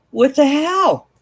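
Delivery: high, loud baffled outburst; retold disbelief. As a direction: burst out in mock outrage. Retold shock at wrong magazine.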